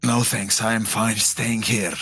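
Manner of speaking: ordinary talk, nothing marked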